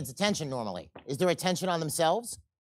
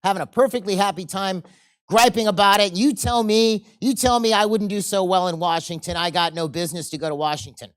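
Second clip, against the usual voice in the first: mock indignant rant, getting louder; building angry rant; 2 semitones higher, 7 dB louder than usual. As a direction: work yourself up into a loud grievance. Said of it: "The angry fellow says".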